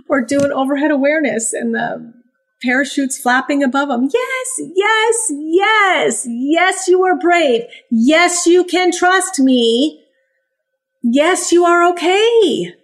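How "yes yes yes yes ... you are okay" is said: high, bright-pitched puppy praise; upbeat encouragement. Acted. Trainer to puppies under flapping parachutes, upbeat encouragement. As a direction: cheer a nervous pup along, pitched well up.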